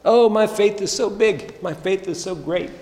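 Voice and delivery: high, puffed-up mock brag; a touch of hammed-up spiritual pride; mock boasting. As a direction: play the smug show-off for a laugh. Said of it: "Otherwise, we could boast".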